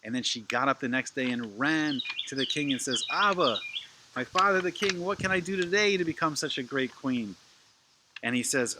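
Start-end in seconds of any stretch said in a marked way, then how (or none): 3.1-7.4 put-on higher, louder child-princess voice; eager little princess. Little princess asking her father the king what to do, eager. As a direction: call out keenly to father the king.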